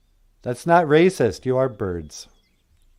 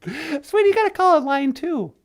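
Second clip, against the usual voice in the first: much higher playful lady voice; high female voice. Pretend. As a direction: play the sweet girlfriend calling in, high. Natasha voice.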